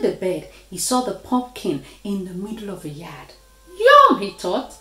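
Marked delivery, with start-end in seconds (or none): 3.8-4.2 high, loud burst of hungry delight; delighted, high-pitched exclamation; eager yum. Acted. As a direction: pipe up loudly at the food. Dog spots the pumpkin.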